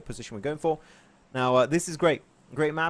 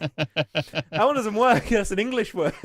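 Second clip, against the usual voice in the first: slow, laughing words, higher and louder; laughing through it; 7 semitones higher, 5 dB louder, slower than usual. Retold or imitated. Caster laughing at his own slip on air. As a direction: giggle out the correction. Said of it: "And I'm like".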